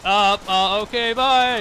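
mock alarm. Acts out a scared reply to threat.

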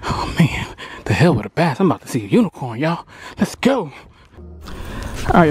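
normal talking voice

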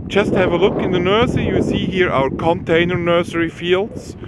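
ordinary talk, nothing marked

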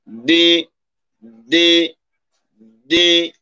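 দি said with the tongue tip pressed hard on the upper teeth roots; teacher demonstrating di. Shown as a demonstration. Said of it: "উচ্চারণটা দেখি".

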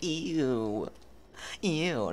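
put-on loud, grossed-out girl voice; a loud, squealed "ew"; disgusted girl. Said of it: "she's gonna be like".

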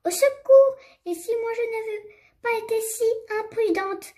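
high-pitched storybook wail; squeaky-high, play-acted mouse lament; moaning mouse. A narrator's reading. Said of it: "gémit la souris".

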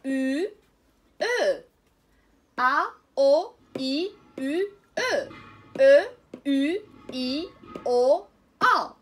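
loud, drawn-out teacher drill of each syllable. Shown as a demonstration. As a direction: belt out each sound for the class to copy. Drills French syllables aloud.

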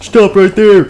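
high, loud play-acted order; mock-heroic challenge; commanding shout. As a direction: shout it like a toy guard. Guard confronts the criminal.

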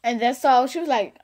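ordinary delivery

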